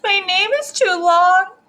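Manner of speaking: mock tearful whine, building to the end; crying child. A narrator's reading. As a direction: bawl it out like an upset little girl. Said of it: "I think she's crying".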